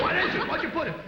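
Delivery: high-pitched comic panic; mock alarm. Husband poisoned on the floor, hammed-up panic. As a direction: demand it as if just poisoned.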